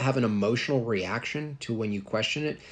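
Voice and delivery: ordinary talk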